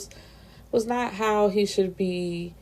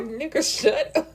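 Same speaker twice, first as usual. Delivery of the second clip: exasperated; 7 semitones higher than usual. Real, not acted. Exasperated commentary on drunk Rule.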